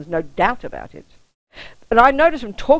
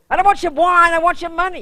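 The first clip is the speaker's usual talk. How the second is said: imitating the mugger; 10 semitones higher, 5 dB louder than usual. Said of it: "he kept saying".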